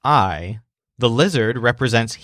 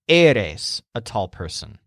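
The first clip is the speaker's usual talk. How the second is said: "Eres" with the first syllable stressed, like "air"; 3 semitones lower than usual. Shown as a demonstration. Teacher shows learners the stress, first syllable of "Eres" stressed. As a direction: lean on the E of "Eres", second syllable lighter. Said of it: "remember that you're stressing the first syllable".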